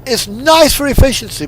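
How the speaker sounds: ordinary delivery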